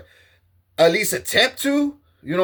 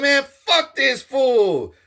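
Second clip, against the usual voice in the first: shouted scorn at a rival; shouted dismissal; 5 semitones higher, slower than usual. Retold or imitated. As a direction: shout it slow and contemptuous.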